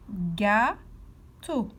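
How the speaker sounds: "Gâteau" drawn out in two separated syllables. Shown as a demonstration. Teacher showing pupils the last picture, "Gâteau" syllable by syllable. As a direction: pause clearly in the middle of "Gâteau". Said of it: "Colorie la case où on entend".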